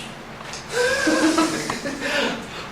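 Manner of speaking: breathy, voiceless chuckle; long amused laugh at one's own mistake; real laugh. Real, not acted. Laughs at own drawn symbol.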